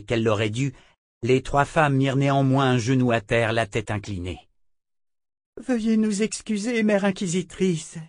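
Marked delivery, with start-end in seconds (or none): 5.6-8.0 Grace repeating the apology. A narrator's reading. Sister Grace repeats her apology to Callan, high and humble. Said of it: "répéta sœur Grace".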